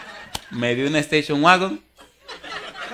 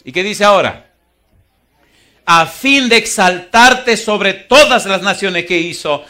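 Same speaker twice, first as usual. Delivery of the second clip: preaching at full force; 7 semitones higher, 11 dB louder than usual. Acted. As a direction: thunder the verse at the congregation.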